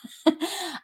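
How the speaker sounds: high, choked little laugh through tears; teary laugh. Real, not acted. Admitting to viewers she is teary, choked laugh. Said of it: "I'm getting teary-eyed".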